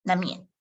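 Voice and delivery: 难免 with the first N dropped into the M; teacher's natural-speed model; connected pronunciation. Shown as a demonstration. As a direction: say 难免 smoothly, no second N. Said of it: "no need to pronounce the, the second N".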